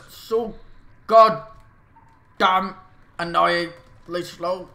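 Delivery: exasperated outburst. Real, not acted. Frustrated at slow unit.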